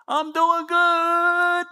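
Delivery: high, slow, drawn-out self-satisfied boast; smug boast. Retold or imitated. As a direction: stretch it out, proud of yourself. Mock smug runner.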